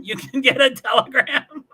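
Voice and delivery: high laughing question; amused disbelief. Real, not acted. Host answering a viewer's possible joke, laughing in disbelief.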